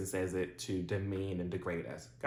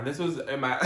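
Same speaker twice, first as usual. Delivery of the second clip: ordinary talk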